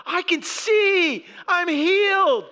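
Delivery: high, rising joyful cries; a man shouting his joy at seeing; excited healed man. Acted. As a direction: burst out with the thrill of new sight. Preacher voicing the healed man.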